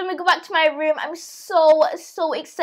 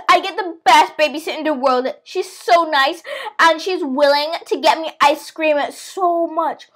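normal talking voice

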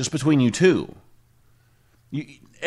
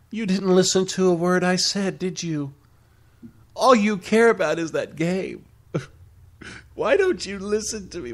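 put-on nagging girlfriend; 5 semitones higher than usual.

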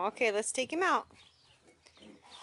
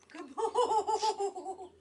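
laughing praise; 8 semitones higher, 5 dB louder than usual. Real, not acted.

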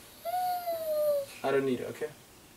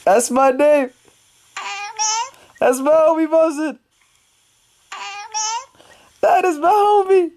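proud excitement; 14 semitones higher than usual. Real, not acted. Baby said his name.

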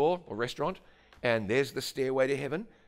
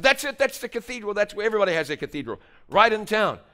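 loud, high-pitched burst of recognition; excited exclaiming; 9 semitones higher, 7 dB louder than usual. Retold or imitated. Telling the operator that's the cathedral, excited. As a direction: exclaim it louder, as if it's found. Retells his excited answer on phone.

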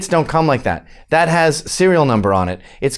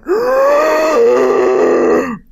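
long, high mock gasp; exaggerated drawn-out gasp of alarm; panicked gasp; 8 semitones higher than usual. Teacher's mock reaction to the clock.

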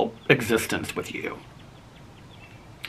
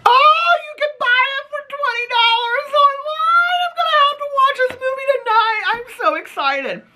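excited squeal; 3 dB louder than usual. Real, not acted. Discovers she can rent movie.

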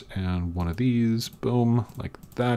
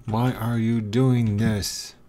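slow exasperation; slower than usual. Real, not acted. Pig keeps going wrong way.

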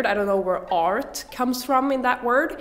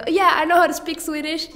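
higher-pitched mock show-off; put-on, raised voice of a would-be speaker; boastful Swedish speaker; 7 semitones higher, 4 dB louder than usual. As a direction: play someone proudly claiming fluency. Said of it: "be like".

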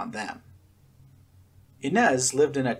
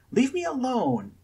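much higher, tearful plea; crying plea; 13 semitones higher than usual. A narrator's reading. Scarlet begging the twins, tearful plea. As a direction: plead through tears. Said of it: "Scarlet cried".

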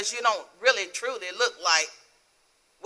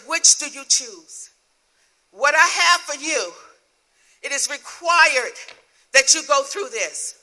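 loud, slow and raised preaching delivery; commanding divine voice; 3 semitones higher, 5 dB louder, slower than usual. Retold or imitated. God tells a believer what is required, loud and commanding. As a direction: proclaim it slowly as God's own command.

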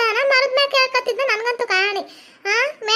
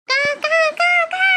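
crow cawing; 5 semitones higher than usual. Acted. Crow crying out from the tree, high cawing. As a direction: caw like an alarmed crow.

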